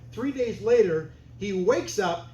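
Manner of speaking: ordinary talk, nothing marked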